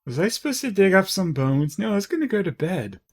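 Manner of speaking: high-pitched forgetful wondering; playful baffled character voice; mock confusion. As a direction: ask it puzzled, then brush it off.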